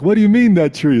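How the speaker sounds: high, loud incredulous question; baffled retort. Retold or imitated. Drunk brothers answering the boyfriend, loud baffled retort. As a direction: throw it back in loud bewilderment.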